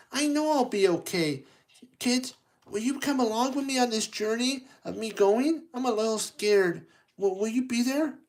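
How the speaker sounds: a hint of a scared engine asking for friends; nervous Thomas. Thomas asking kids to come along, lightly nervous.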